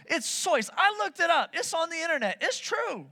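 high-pitched protest; mock-indignant insistence. Preacher defending his fact to doubters, indignantly insistent.

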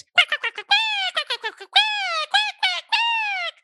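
high, rapid bursts of quacks; excited duck quacking. Retold or imitated. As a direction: chatter like an overjoyed toy duck. Duck answers happier child's emoji.

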